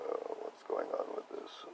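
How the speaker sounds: whispered, low and drawn out; mock whisper. Talking to herself about feeling icky, a weary whisper. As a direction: breathe it out like a weary sigh. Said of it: "I'm like".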